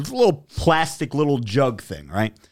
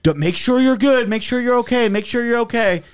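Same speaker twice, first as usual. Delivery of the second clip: much higher, louder mock fussing; fussing staff; 10 semitones higher, 3 dB louder than usual. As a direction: repeat it like worried staff nagging. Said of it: "kept telling me over and over".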